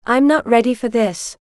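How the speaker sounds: ordinary delivery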